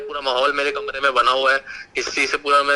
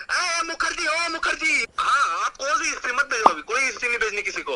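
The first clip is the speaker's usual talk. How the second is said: high, panicked calling out; frantic pleading; 11 semitones higher than usual. Real, not acted. Victim begging Mukherjee not to send history, frantic. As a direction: yelp urgently to stop them, pitch way up.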